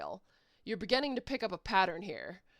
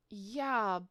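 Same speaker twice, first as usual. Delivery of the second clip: ordinary talk, nothing marked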